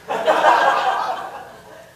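a burst of real laughter at his own punchline; loud laugh. Real, not acted. Lecturer laughing at the French jab on sausages, loud laugh. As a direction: laugh out loud, pitch jumping high.

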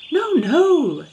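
high, loud shocked protest; shocked denial. A narrator's reading. Both gentlemen answer the boy's blunt guess, shocked. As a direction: protest as if sincerely scandalized. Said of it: "were sincerely shocked".

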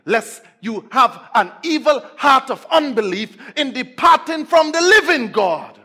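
emphatic preacher reciting, intensity rising; forceful preaching. Real, not acted.

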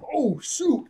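high-pitched alarmed exclamation; startled yelp. Retold or imitated. Nami spotting the enemy ship, startled high yelp. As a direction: yelp it as if caught out.

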